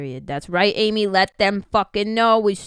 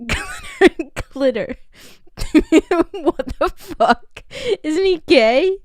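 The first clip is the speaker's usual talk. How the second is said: laughing; 5 semitones higher, 5 dB louder, slower than usual. Real, not acted. Still laughing at the slip.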